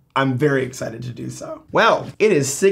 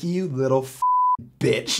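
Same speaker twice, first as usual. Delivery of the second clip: slow seething insult; 3 semitones lower, 5 dB quieter, slower than usual. Retold or imitated. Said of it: "And I'm like".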